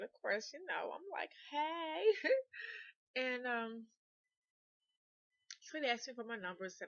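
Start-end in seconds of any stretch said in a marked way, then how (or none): 1.5-2.5 high, loud hey breaking into a laugh; re-enacted coy, giggly greeting; flattered giddy hey. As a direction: play it tickled by the compliments. Acts out flattered reply to compliments.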